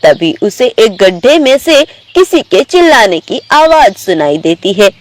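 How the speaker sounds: plain reading voice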